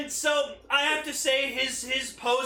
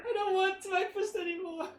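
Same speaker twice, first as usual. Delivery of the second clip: mock character voice; 11 semitones higher, 3 dB quieter than usual.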